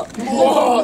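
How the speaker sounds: sudden high yell of alarm; startled warrior yell. Retold or imitated. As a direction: cry out as the arrows fly. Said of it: "って". (驚いた叫び声)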